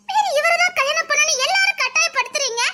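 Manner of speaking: tearful plea, rising high; crying. Acted. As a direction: plead through sobs, voice rising. Bride pleads against forced marriage.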